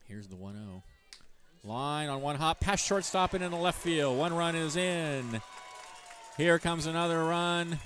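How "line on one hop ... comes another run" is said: loud, high play-by-play burst; excited home run call. Real, not acted. Announcer to radio listeners, roaring the big hit. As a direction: call the hit like the crowd's on its feet.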